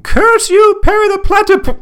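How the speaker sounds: very high, loud muffled "curse you" cry; Doofenshmirtz from inside a cave. Retold or imitated. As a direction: shout the villain's curse as if stuck inside a cave. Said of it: "hear Doctor Doofenshmirtz from inside the cave say".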